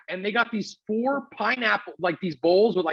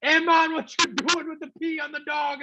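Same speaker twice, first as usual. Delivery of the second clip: high-pitched, badly faked Jamaican accent; 9 semitones higher than usual. Host imitates the super flipping out over his dog, shaky attempt at a Jamaican accent. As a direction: yell it in a rough mock Jamaican accent, angry. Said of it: "that was a Jamaican accent".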